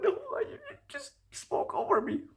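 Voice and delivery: very high quiet mocking voice; mocking girlfriend. Guy mimics date's complaint, very high and mocking. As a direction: squeak out her complaint. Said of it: "she out here wanna talk about".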